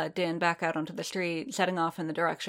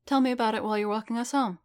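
quick, higher and brighter-paced; brisk young woman voice; 5 semitones higher, faster than usual. Acted. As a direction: fire it off briskly as Gita. Gita voiced in story.

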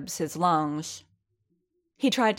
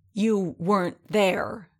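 slow, growled protest; drawn-out angry growl; 3 semitones higher, slower than usual. Acted. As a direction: growl it out slowly. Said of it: "Din growled".

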